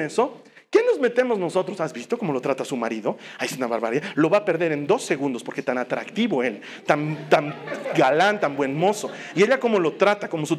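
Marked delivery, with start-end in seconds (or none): 1.8-9.1 low gossiping voice. Retold or imitated. Gossiping about a couple.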